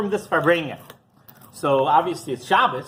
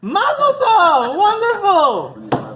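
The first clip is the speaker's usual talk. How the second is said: high, loud, slow joyful cry; joyful mazel tov; 7 dB louder, much slower than usual. Retold or imitated. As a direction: burst out with delight at the news.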